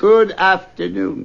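slow, pointed goodbye; firm dismissal. Acted. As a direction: insist he leave now. Scrooge repeats dismissal firmer.

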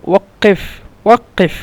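"ওয়াক্কেফ" with the k-like qaf held double; doubled qaf. Shown as a demonstration. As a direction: lean on the doubled middle consonant of "ওয়াক্কেফ". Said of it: "দুইবার উচ্চারণ হবে".

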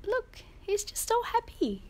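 high, doting pet voice; cooing to the cat. Real, not acted. Owner showing off her happy cat in a high pet voice.

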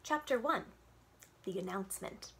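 usual speaking voice

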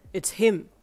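loud, petulant accusation; sulky protest; pouting. A narrator's reading. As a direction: blame him like a spoilt child. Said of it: "he pouted".

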